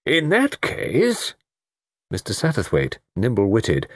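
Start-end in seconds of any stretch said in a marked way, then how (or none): 0.1-1.3 very slow, high, stretched words; long-drawn wondering repeat; dawning realisation. Acted. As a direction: draw it right out, sentence left hanging. Melrose trailing off in realisation.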